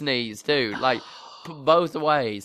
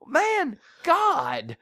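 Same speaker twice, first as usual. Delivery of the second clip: exasperated groan; 13 semitones higher than usual. Retold or imitated. Retelling his anger at daughter.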